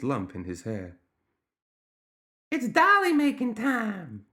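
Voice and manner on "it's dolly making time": put-on very high, drawn-out child voice; sing-out high and slow like a little gremlin girl; squeaky, insistent gremlin kid. A narrator's reading. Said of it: "the child said".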